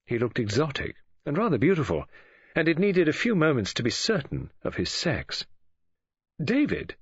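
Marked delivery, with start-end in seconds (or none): 6.4-7.0 higher-pitched sharp call of a name. A narrator's reading. Woman surprising young intruder on the stairs, sharply. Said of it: "Mary Restaric spoke sharply".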